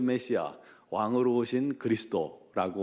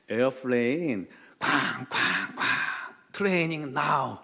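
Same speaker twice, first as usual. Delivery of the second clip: mock broken-English explanation with imitated booms; halting English explanation; 3 semitones higher, much slower than usual. Cab driver explaining an air drill to a foreign passenger, halting English. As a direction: spell it out word by word, stretching the last. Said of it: "영어로 설명을 합니다".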